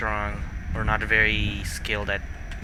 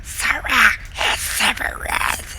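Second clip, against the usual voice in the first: character voice; 13 semitones higher, 4 dB louder, slower than usual. Acted. Doing a character voice.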